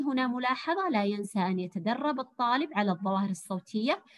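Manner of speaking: ordinary talk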